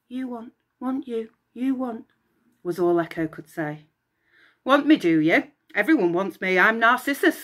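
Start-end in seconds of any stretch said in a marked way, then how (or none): 0.1-2.1 faint echo. Acted. Cursed Echo repeats. 4.6-7.4 louder, vain mocking scoff; a touch of arrogant sneer. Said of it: "scoffed the vain young man".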